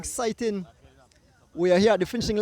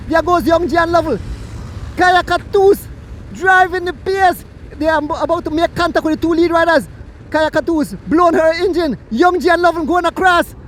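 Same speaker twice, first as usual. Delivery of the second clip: quick, raised play-by-play call; race commentary; 6 semitones higher, 8 dB louder than usual. Acted. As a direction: call the finish of the bike race live.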